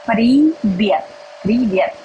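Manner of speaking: ordinary delivery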